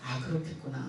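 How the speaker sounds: 아, 그렇겠구나 breathed very softly and low; inner voice. Retold or imitated. Thinking silently about the neighbor, barely audible murmur. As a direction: murmur 아, 그렇겠구나 to yourself under your breath. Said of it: "내가 속으로 그랬어요".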